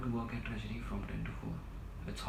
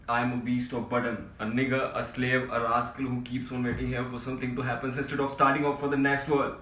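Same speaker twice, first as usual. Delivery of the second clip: loud, impassioned outburst building in force; worked-up shouting; 3 semitones higher, 8 dB louder than usual. Acted. Desperate man ranting to his friend about his life, shouting. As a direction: rail loudly at your own lot. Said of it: "Don't shout".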